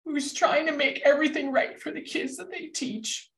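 high strained voice, real tears held back; emotional, choked up. Real, not acted. Thanking hardworking teachers after a pandemic, genuinely choked up. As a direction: push through the emotion with long pauses. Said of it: "I get emotional about this".

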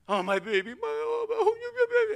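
hammed-up mother wailing over baby; very high, loud mock-crying fuss; fussing, wailing mom. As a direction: fuss over a hurt child in a squealing falsetto. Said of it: "it's usually mom that runs to them".

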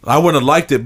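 ordinary delivery

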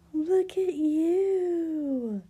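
high, loud, drawn-out coo; delighted cooing. Acted. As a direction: gush over the baby slowly. Mother cooing at dressed baby.

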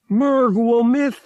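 high, slow, alien catlike rasp; a slow, strange creature speaking English; alien Togorian voice. Acted. Togorian copilot to Han about his leaving, alien voice.

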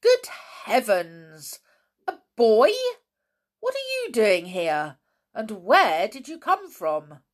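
loud, astonished outburst; surprised exclamation. A narrator's reading. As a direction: exclaim in loud disbelief, then question. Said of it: "There was a surprised exclamation".

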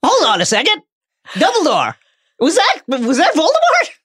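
high, stunned disbelief; panicked Fudge. Retold or imitated. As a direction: gasp it out in shock.

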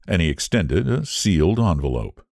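ordinary delivery

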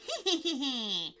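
wicked pixie cackle; nasty laugh. A narrator's reading. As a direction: laugh nastily at the victims. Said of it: "laughing nastily".

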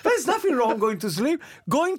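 high laughing protest. Real, not acted. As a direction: laugh it out, defensive. Laughs about sleeping.

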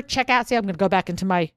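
normal talking voice

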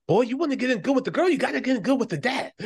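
Coming to America impression. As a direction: rattle it off like a comedy film elder giving advice. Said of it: "Coming to America".

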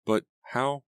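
high-pitched, startled disbelief; startled question. A narrator's reading. Natalie reacting to Keith's accusation, startled high question. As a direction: lift it high, taken aback.

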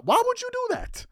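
very high, incredulous squeal at ordinary volume; squeaky disbelief. Retold or imitated. Asking about the guy who lost his job, high-pitched disbelief. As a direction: plead it way up high without shouting.

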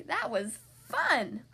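slow, relishing delight; crying out happily. A narrator's reading. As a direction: stretch it out with storybook glee. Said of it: "cried Duck".

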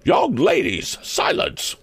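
high schoolmistress command; stern cry. A narrator's reading. Principal hushes her chattering pupils, stern. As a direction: call for silence as a strict matron. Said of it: "cried Miss Perkman".

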